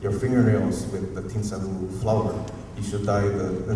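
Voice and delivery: ordinary talk, nothing marked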